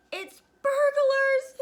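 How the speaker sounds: very high, loud, drawn-out alarm; frightened mother's strained high cry; panicked hiss. Acted. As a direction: shriek it slowly in terror. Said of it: "hissed the mother".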